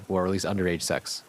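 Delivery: plain reading voice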